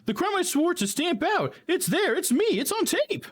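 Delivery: high, eager rookie officer; high excitable cop voice. Acted. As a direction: play him as a jumpy, overeager young cop.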